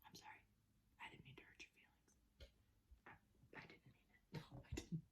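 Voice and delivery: hushed, tender whisper to the dog; barely voiced, soothing a hurt pet; whispered apology. Real, not acted. Whispering sorry to his dog, hushed and tender.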